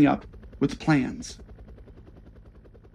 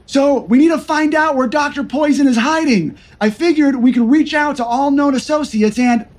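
eager nervous agent; 9 semitones higher, 3 dB louder than usual. Acted. Eager Greenlee proposes plan.

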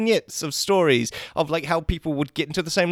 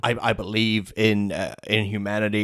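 Thanos; 8 semitones lower than usual. Acted. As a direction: put on a big low Mad Titan voice. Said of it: "interview with Robert Downey Junior and Thanos".

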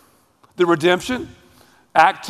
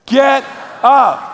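shouted together, raised; loud call with the congregation; 5 semitones higher, 4 dB louder than usual. Real, not acted. Preacher leading congregation in a shout. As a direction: shout it out with everyone. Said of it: "Say, "Get up" with me".

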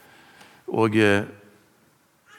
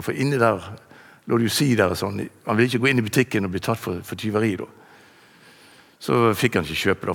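ordinary talk, nothing marked

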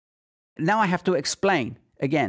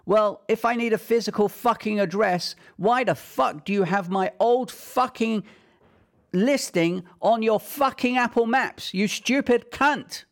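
furious rant; 6 semitones higher than usual. Real, not acted. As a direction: blow up at them, dragging out the final insult. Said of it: "I'm just fucking furious".

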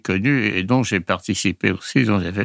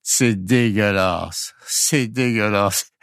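put-on drawn-out, slow Pakistani-accented French; slower than usual. Storyteller mimics a Pakistani waiter's newly learned French, in his accent. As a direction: stretch each syllable in an imitated Pakistani accent. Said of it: "je le dis avec l'accent".

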